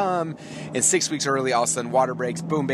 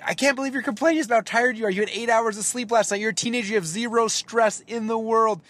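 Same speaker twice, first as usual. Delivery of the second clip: way higher, exasperated disbelief; incredulous rant; 11 semitones higher than usual. Retold or imitated. Exasperated husband rants at tired teenage cashier, high pitched disbelief. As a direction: rant high and fed up at the lazy excuse.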